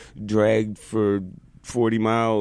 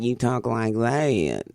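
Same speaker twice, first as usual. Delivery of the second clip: drawn-out Texas twang; put-on Texas accent; Conroe Texas accent; much slower than usual. Shown as a demonstration. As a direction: stretch it into a slow Texas drawl. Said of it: "if you come from Conroe".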